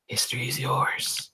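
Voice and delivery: breathy whisper of the walls; eerie whisper. Acted. The cathedral walls tempting the hero, whispered. Words scrawled around the room.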